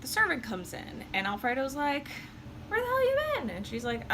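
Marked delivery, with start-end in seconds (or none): 2.7-3.5 much higher, louder outraged question; indignant demand. Retold or imitated. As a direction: snap at the servant for being gone. Alfredo confronts the servant.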